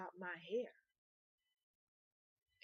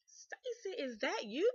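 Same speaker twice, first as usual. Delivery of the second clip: high-pitched, rising surprised question; acted-out startled greeting; surprised recognition; 7 semitones higher, 6 dB louder, slower than usual. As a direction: imitate someone delightedly spotting an old friend. Ex recognizes her in bar.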